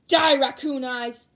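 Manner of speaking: slow, loud death threat; furious yell. Acted. Bakugo threatening raccoon eyes, furious yell. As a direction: scream it at her.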